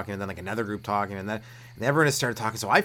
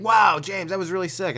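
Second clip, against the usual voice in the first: high, louder burst of admiration; impressed fan; 8 semitones higher, 7 dB louder than usual. Retold or imitated. Friends hearing James freestyle, impressed. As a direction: gush like an impressed listener.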